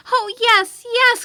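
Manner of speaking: put-on high, loud excited children's cry; a burst of delighted shouting from the kids; eager cry. A narrator's reading. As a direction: call out yes yes like thrilled children. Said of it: "cried the children".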